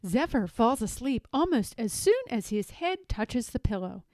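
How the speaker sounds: ordinary delivery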